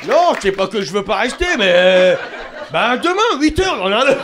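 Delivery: high, loud whiny civil-servant voice; hammed-up lazy clerk; mock civil servant. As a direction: whine like a clock-watching clerk. Imitating lazy civil servant at the front.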